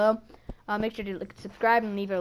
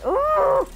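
very high, loud drawn-out whoop of dismay; anguished high "ooh"; 9 dB louder than usual. Real, not acted. Gamer fifty points short, anguished high cry. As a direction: squeal in frustration at a near miss. Agony over the near miss.

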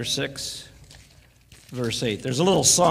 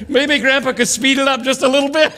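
higher and louder, laughing through it; laughing grandma; 7 semitones higher, 8 dB louder than usual. Retold or imitated. Grandma nudging grandpa to speed up, laughing. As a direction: laugh while passing on grandma's hint. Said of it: "Grandma says".

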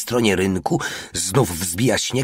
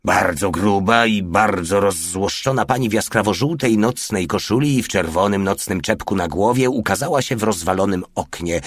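usual speaking voice

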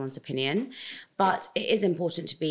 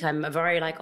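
normal talking voice

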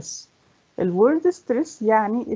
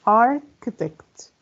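"architect" slowly, pausing after a raised "ar"; syllable by syllable; 4 semitones higher, 3 dB louder, much slower than usual. Shown as a demonstration. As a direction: set "ar" apart from "chitect". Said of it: "كم عدد المقاطع".

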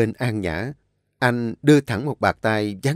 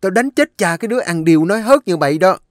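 furious, louder and higher, fast; angry mistress; 6 semitones higher, 5 dB louder, faster than usual. A narrator's reading. Mistress berates servant girl Sen, furious shouting. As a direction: tear into the lying maid. Mistress scolds lying maid.

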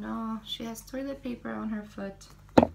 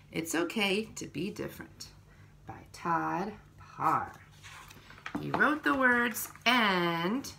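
ordinary delivery

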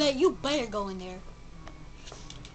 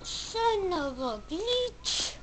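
slow drawn-out pun; 6 semitones higher, 4 dB quieter, much slower than usual. Retold or imitated. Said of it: "From where I got that from".

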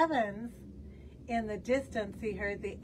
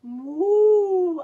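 high, much louder long moo; drawn-out loud moo; cow moo; 8 semitones higher, 13 dB louder than usual. Acted. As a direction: bellow like an unhappy cow. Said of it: "moaned the cow".

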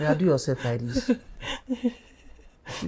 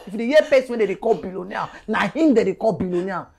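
normal talking voice

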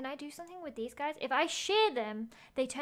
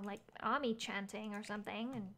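ordinary delivery

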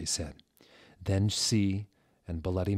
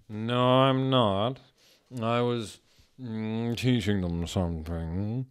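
slow, higher, a bit different voice; a hint of a defensive Sirius; lightly voiced Sirius; 3 semitones higher, slower than usual. Sirius answering Snape, lightly voiced and slow.

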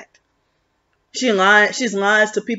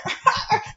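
high, softer mocking titter; forced fake laugh; 14 semitones higher, 6 dB quieter than usual. Showing how Lanika laughs, forced and fake. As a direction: imitate an over-exaggerated laugh, pitched up. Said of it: "forced, fake laugh".

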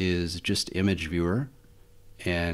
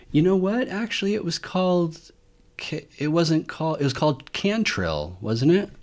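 higher, eager, just remembered it; sudden recollection; 9 semitones higher than usual. Real, not acted. Reviewer suddenly recalling the old GNOME font, eager. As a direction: pitch jumps up as the name comes back.

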